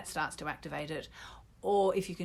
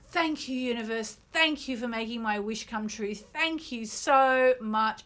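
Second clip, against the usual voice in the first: gasping, ecstatic, much higher and louder; over-the-top gratitude with gasps of delight; frenzy of happiness; 8 semitones higher, 5 dB louder than usual. Shown as a demonstration. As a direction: burst with giddy joy as if the wish came true. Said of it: "Say it out loud with me".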